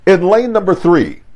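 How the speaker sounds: announcer build-up, stretching the final name high and loud; lightly voiced track announcer, swelling at the end; stadium announcer. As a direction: hype the crowd like a meet announcer. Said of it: "that announcer's voice".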